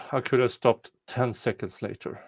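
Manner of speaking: normal talking voice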